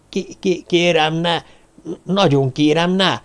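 high-pitched, stammering plea; frightened pleading. Acted. Victim begs attackers to stop, frightened pleading.

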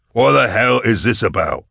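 put-on loud, half-drunk challenge; belligerent. A narrator's reading. Drunk Falcon belligerently demands what this is about. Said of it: "Falcon asked belligerently".

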